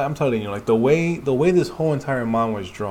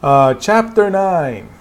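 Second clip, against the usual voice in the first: higher, louder and slow; emphatic correction; 8 semitones higher, 8 dB louder, much slower than usual. Real, not acted. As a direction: stretch out the correction.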